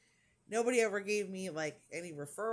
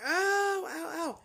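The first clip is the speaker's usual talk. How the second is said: high "ow", loud then easing off; imitated pain yelp; 10 semitones higher, 5 dB louder, slower than usual. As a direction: re-enact a pained yelp short of a scream. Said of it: "I didn't scream, but I'm like".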